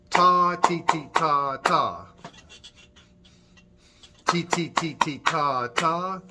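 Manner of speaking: teacher chanting rhythm for the class to clap; rhythm syllables. Shown as a demonstration. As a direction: call the rhythm out in steady beats. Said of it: "ready to clap a few rhythms with me".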